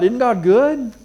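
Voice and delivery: high, pleading question; questioning doubter. Acted. Preacher voicing a doubting believer, high pleading. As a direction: voice the doubter's cry.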